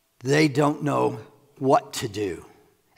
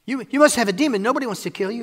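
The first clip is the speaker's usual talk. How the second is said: high, loud retort from a crowd; acted disbelief, pitched high; scoffing accusation; 10 semitones higher, 7 dB louder, faster than usual. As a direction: dismiss him loudly as out of his mind.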